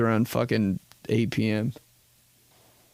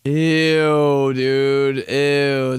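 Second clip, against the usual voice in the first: 1 disgust; 2 semitones higher, 5 dB louder, much slower than usual. Real, not acted. Disgust at catfish.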